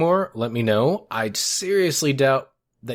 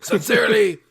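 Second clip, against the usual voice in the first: higher whiny letter voice; put-on letter writer voice; 3 semitones higher, 3 dB louder than usual. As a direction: read like a clueless fan. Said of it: "this accent has gone".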